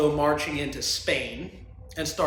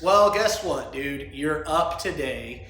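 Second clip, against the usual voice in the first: ordinary talk, nothing marked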